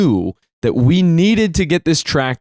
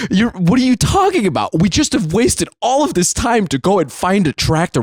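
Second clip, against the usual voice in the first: higher-pitched, incredulous retort; retold exasperation, voice pitched up; indignant; 6 semitones higher than usual. Son objecting to dad backing out, indignant.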